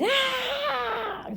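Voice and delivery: very high, soft mock shriek; imitated scream. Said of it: "e ele gritou assim". (grito agudo imitado, baixinho)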